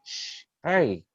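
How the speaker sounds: comic panicked calming; mock hushing dad.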